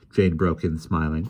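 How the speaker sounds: usual speaking voice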